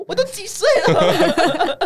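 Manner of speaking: high, loud, drawn-out through laughter; slow mock protest, breaking into laughter; laughing retold retort. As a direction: imitate the indignant wife while cracking up. Retelling the wife's retort, laughing.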